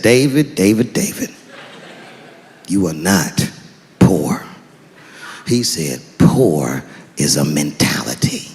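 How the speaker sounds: very low, slow, solemn father; deep slow dad correcting his son. Retold or imitated. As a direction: lecture your son gravely and low.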